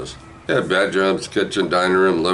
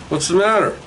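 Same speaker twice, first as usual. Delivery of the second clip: much higher concerned mother voice; mom asking; 13 semitones higher than usual. Retold or imitated. Mother asking her crying son, in a high worried voice. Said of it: "One goes".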